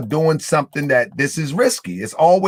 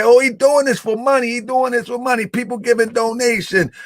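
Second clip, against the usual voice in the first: high-pitched mock of the naysayers; mocking critics; 9 semitones higher than usual. Host quoting his doubters on donations, mocking. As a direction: parrot the complainers way up high. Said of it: "people say".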